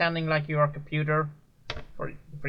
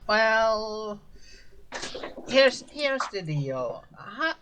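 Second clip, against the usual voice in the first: scheming NPC voice; 6 semitones higher than usual. Acted.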